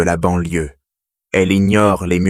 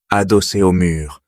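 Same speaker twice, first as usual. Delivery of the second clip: ordinary delivery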